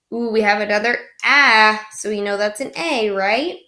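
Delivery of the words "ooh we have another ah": usual speaking voice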